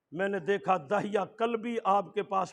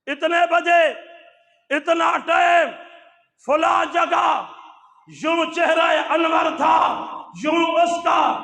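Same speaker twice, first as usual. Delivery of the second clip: higher, louder full-force sermon delivery; fiery preaching; 9 semitones higher, 13 dB louder than usual. Acted. Preacher telling congregation of Gabriel, fiery preaching. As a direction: drive the story home at full power.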